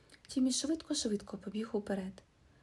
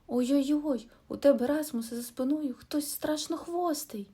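loud, higher alarmed warning; frightened cry; 6 semitones higher, 6 dB louder than usual. A narrator's reading. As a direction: warn a friend of something behind. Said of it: "Тім закричав".

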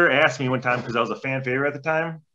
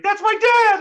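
mock little-girl cry, much higher and louder; imitating the daughter's high shout; kid's voice; 7 dB louder, slower than usual. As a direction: squeal it like a scared kid. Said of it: "she's like".

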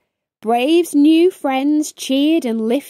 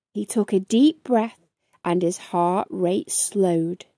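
ordinary delivery

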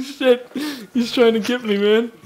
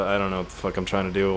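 normal talking voice

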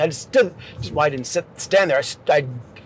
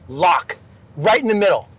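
ordinary delivery, nothing marked

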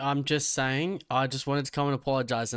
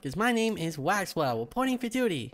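Maxwell; 6 semitones higher than usual. Pretend. Mock trooper reporting for duty, high nerd voice. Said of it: "It's the Maxwell accent".

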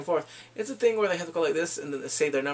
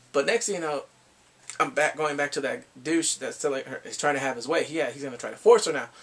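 ordinary talk, nothing marked

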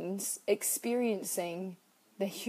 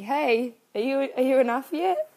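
higher, louder mocking check-in; nagging inner critic; 6 semitones higher, 7 dB louder than usual. Her inner critic checking in, higher, louder mock taunt. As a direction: tease yourself as the inner critic. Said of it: "being like".

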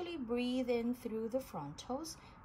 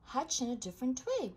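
ordinary delivery

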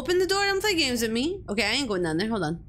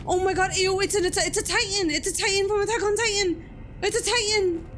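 freaked out; 8 semitones higher, faster than usual. Real, not acted. Freaked out by creature.

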